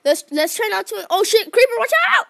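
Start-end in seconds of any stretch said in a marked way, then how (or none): 1.1-2.3 high, loud sudden warning; alarmed warning shout. Real, not acted. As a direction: yell to warn a friend of danger. Warning friend of creeper.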